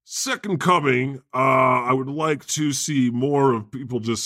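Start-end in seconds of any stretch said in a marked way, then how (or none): none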